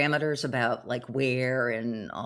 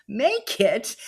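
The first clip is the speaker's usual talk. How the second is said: incredulous echo; 7 semitones higher, 5 dB louder than usual. Real, not acted. Mocking his words back.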